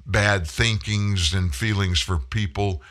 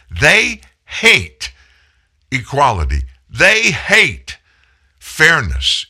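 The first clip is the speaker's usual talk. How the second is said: they hate punched high and loud; indignant emphasis; 5 semitones higher, 5 dB louder, slower than usual. Real, not acted. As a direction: hammer each they hate with outrage. Radio host rails against the left.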